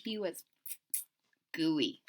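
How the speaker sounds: ordinary delivery, nothing marked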